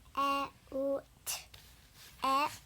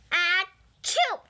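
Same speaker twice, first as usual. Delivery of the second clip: big acted sneeze, loud; loud fake sneeze; 2 semitones higher, 10 dB louder than usual.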